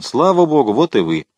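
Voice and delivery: exclaimed welcome, starting high; exclaiming. A narrator's reading. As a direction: burst out in relieved greeting, then drop. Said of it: "воскликнул он". (восклицая с облегчением)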